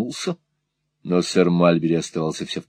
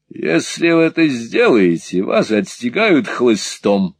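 raised voice, drawn-out menace; angry threat; 4 semitones higher, 4 dB louder, slower than usual. Acted. Sir Mulberry warning Nicholas off the carriage, angry threat. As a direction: threaten him, slowing on the punishment.

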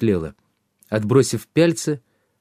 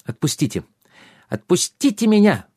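high, shrill cry to be released; muffled cry; 9 semitones higher than usual. A narrator's reading. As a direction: squeal to be let go. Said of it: "выкрикнула из кулака".

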